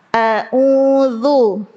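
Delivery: a'udzu with a light, thin hamzah; a'udzu, hamzah kept thin, mouth not wide open. Shown as a demonstration. Teacher models the correct thin hamzah for the class. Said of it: "Ditipisin, hamzah itu tipis".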